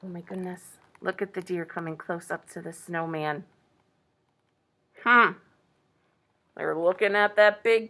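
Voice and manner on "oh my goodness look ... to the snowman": soft, low hush; hushed awe. Real, not acted. Reader points out approaching deer to children, hushed. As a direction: speak quietly so nothing is startled.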